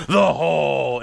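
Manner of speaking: loud mock-dramatic story title; shouted deep voice. Mom announcing the family's made-up story, booming shout. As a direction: boom it out like an epic title. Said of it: "they have to shout".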